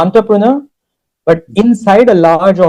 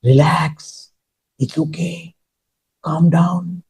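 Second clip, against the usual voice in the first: soft, soothing self-talk with long pauses; quiet voice of inner peace; calming inner voice; 2 semitones lower, 6 dB quieter, slower than usual. Acted. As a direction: gently talk yourself down, hushed and unhurried. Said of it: "an inner voice that comes and says".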